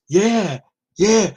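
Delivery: high, lightly mocked eager yeah; eager drunk heckler. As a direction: imitate a drunk taking the bait. Acts out drunk heckler's eager answers.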